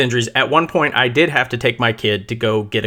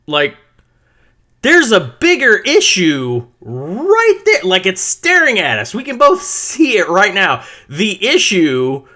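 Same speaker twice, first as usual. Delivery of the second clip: heated retort; 6 semitones higher, slower than usual. Retold or imitated. Parent telling the coach off over the hurt kid, heated. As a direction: push back hard, spelling out the obvious. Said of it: "And I'm like".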